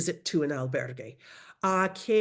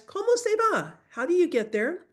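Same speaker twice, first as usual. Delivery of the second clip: very high, bright-sung drill line; teacher hamming up the phrase high; animated drill; 12 semitones higher than usual. Shown as a demonstration. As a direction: exaggerate the question for practice. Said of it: "you guys".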